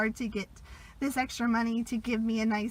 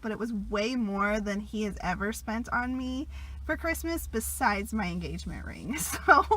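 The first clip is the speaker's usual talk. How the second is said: ordinary delivery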